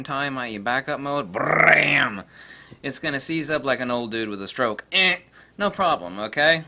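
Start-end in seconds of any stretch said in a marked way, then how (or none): 1.3-2.2 loud rolled "brrram" burst; a camera being pounded, in one loud burst; camera burst noise. Retold or imitated. Imitates rapid continuous camera burst. 4.9-5.2 loud, abrupt "eh" croak; sudden choked noise of a camera dying; seizing-up noise. Retold or imitated.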